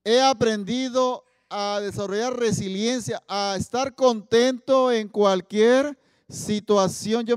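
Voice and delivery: loud and very slow, each phrase weighed; solemn scripture reading. Acted. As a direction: preach the verse with full conviction. San Pablo quoted from the Bible. (proclamando el versículo, fuerte y muy lento)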